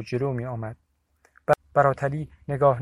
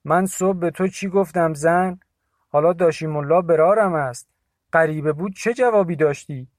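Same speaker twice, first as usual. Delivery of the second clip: higher, louder scolding of wife; strained angry voice; 4 semitones higher, 7 dB louder than usual. A narrator's reading. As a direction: reproach her sharply. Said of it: "صدایش رگدار شده بود". (سرزنش تند با صدای رگدار)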